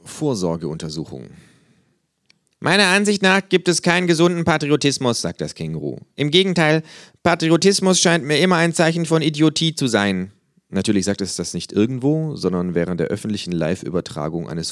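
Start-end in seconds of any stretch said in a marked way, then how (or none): 2.6-5.2 high, dry, know-it-all kangaroo. A narrator's reading. Kangaroo lecturing a flag-draped fan, high and dry. Känguru voice, held. 6.2-10.3 high, dry, know-it-all kangaroo. A narrator's reading. Kangaroo lecturing a flag-draped fan, high and dry. Känguru voice, held.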